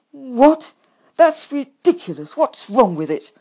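loud, high indignant outburst; crying indignantly. A narrator's reading. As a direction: protest loudly, high-pitched. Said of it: "I cried indignantly".

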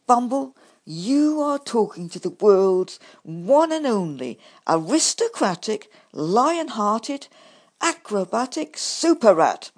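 loud, grand self-praise; boastful. A narrator's reading. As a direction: puff yourself up as a hero. Boastful rat brags loudly.

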